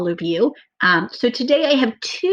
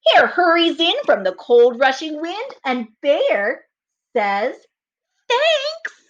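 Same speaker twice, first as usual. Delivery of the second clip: put-on slow, lively read-aloud for children; storybook reading; 8 semitones higher, 3 dB louder, slower than usual. A narrator's reading. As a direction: read it like story time for little kids. Reads story narration aloud.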